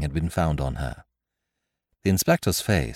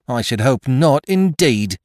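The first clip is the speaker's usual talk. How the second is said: loud, higher prim self-satisfaction; virtuous; 7 semitones higher, 6 dB louder, slower than usual. A narrator's reading. Chambermaid after being searched, virtuously indignant. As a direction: sound smugly virtuous. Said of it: "said the chambermaid virtuously".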